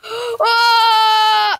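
startled gasp, loud and high; sudden gasp. Real, not acted. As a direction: caught totally off guard. Surprise at sudden win.

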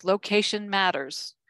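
usual speaking voice

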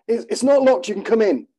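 high, strained, breathless words; gasping in pain. Acted. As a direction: gasp it out through pain. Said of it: "I gasped through pained breaths".

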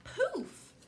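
very high squeaky poof; a sparkly burst; magic poof. A narrator's reading. Reader makes the godmare's tail magic pop, very high.

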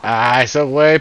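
loud frustrated call-out; loud gamer shout. Real, not acted. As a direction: yell at the teammates. Shouting at teammates in game.

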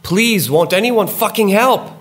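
very high, loud scream for help; the evil voice striking out in a shriek; shrieked plea. A narrator's reading. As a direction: scream it desperately into the woods. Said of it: "the voice strikes out".

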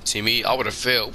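ordinary talk

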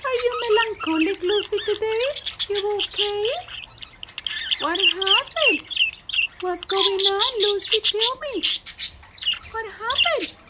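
high, cooing voice for a pet bird; pet talk. Real, not acted.

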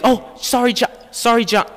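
high, light mock-sorry kid voice; a toddler's careless sorry; little brother apology. Little brother says sorry to John, high and light. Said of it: "it's him, like".

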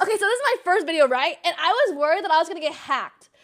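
loud, high, fast explaining; excited rush. Real, not acted. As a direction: race through the story in embarrassment. Explaining old video excitedly.

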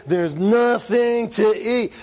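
put-on slow, drawn-out kid's complaint; drawn-out whine. Kid complaining to the parent, slow and drawn out. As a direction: stretch nothing out like a bored kid. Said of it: "They say".

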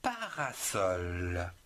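parasol, said slowly with each syllable drawn out; slow syllable-by-syllable model word. Shown as a demonstration. Teacher modelling parasol slowly for children. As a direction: draw out parasol for the pupils. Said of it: "vous avez le mot".

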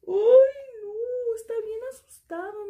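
high, teary voice acting scared for the kids; scared crying. Shown as a demonstration. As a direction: pretends to sob in fright with a high voice. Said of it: "tiene la cara que está bien asustado".

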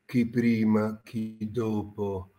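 solemn, soft pompous poet; pompous poet introduction. Acted. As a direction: announce your poems with mock gravity. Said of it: "entrato nel personaggio".

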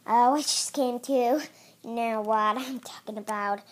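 ordinary talk, nothing marked

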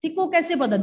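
high, loud, startled demand; Jackson asking sharply. Acted. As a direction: fire the question at him in surprise. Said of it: "So then Jackson asks".

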